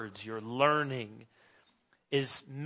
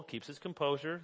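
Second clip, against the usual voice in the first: ordinary talk, nothing marked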